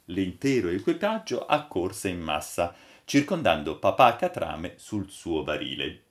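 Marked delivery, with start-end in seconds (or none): none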